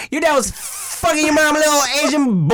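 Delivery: high-pitched taunting delivery; mock roaster piling on insults; energetic roast. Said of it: "you say anything with the right energy".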